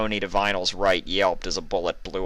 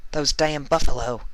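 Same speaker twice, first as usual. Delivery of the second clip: higher, bitter coughed curse; 8 semitones higher, 4 dB louder than usual. Acted. Ruby cursing the buffalo, higher and coughing. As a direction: spit it out between coughs. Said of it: "Ruby coughed".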